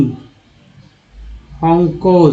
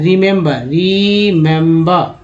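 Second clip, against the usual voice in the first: Remember stretched very slowly, syllable by syllable; slowed-down model pronunciation; slow demo; much slower than usual. Shown as a demonstration. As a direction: draw out remember slowly to show each vowel. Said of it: "इसको उच्चारण क्या कर रहे हैं".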